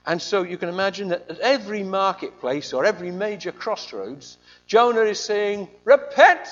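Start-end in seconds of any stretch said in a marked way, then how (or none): 5.8-6.5 high, loud street-preacher cry; shouted repent. Retold or imitated. Jonah shouting "Repent!" at Nineveh's crossroads, loud. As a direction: bellow it across the marketplace.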